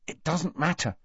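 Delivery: high, hushed impatience; impatient, kept quiet. A narrator's reading. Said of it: "said Harry impatiently".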